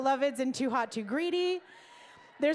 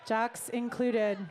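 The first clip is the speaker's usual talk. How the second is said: slow, lower-pitched repeat; slow aside; 5 semitones lower, much slower than usual. Real, not acted. Host repeating that jocks are included, slow low aside. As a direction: draw it out, dropped down low.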